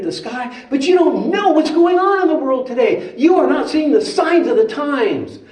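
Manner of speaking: high, loud, emphatic rebuke; animated, exasperated teacher pitched high; exasperated Jesus. Acted. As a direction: scold them in a high, incredulous voice.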